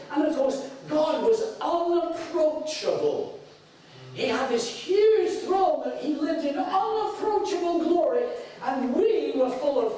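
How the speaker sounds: fervent pulpit crescendo; full-force preaching. Acted. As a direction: build it up like a revival preacher.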